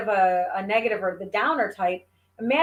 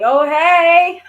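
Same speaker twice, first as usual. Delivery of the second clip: delighted greeting; 6 semitones higher, 10 dB louder than usual. Retold or imitated. Excited greeting to the narrator.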